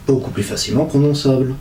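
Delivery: ordinary talk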